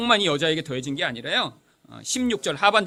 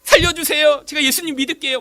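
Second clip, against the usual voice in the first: high, loud child's plea retold; frightened pleading; 6 semitones higher, 8 dB louder than usual. Scared child prays to be spared the cross, frightened pleading. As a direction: beg in mock tearful panic. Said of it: "무서워서 막 울다가 제가 기도했어요".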